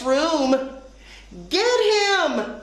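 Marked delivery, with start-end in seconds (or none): 1.5-2.6 high storybook cry to the chase; excited cry. A narrator's reading. Annie to friends in the classroom, high cry. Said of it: "cried Annie".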